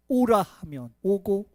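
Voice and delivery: ordinary delivery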